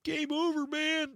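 very high-pitched, wailed movie-quote impression; Private Hudson. Host quoting Aliens to his co-host, high wailing impression. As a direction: wail it way up high like a panicking movie marine. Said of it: "Private Hudson in Aliens, played by Bill Paxton".

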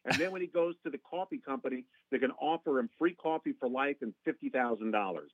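ordinary talk

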